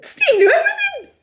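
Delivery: very high, gushing praise; playfully over-impressed; hammed-up admiration. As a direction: squeal it in mock amazement. Gushing over Steve's football knowledge.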